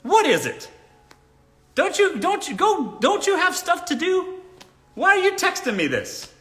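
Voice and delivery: high, loud exasperated challenge; mock indignant reply. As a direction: fire back as if pestered by his texts. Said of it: "You should reply".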